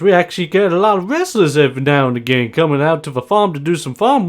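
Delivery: high, loud, talkative farmer; Bob. Acted. Farmer telling wrestlers about farm work, high and enthusiastic. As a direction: pitch farm work high, quick and hearty. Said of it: "Then Bob just looks on over and just goes".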